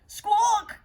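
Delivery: high, loud squawk; loud high hen squawk; startled hen squawk. A narrator's reading. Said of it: "cried the startled hen".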